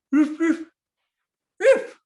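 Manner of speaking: dog answers the call; dog barking. Acted. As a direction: bark like an eager dog. Dog barks.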